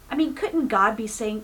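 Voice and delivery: ordinary talk, nothing marked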